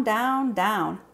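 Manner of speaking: plain reading voice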